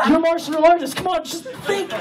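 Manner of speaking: high, laughing self-encouragement; hyped self pep talk. Retold or imitated. Driver urging himself on at the green light. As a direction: psych yourself up, high and laughing.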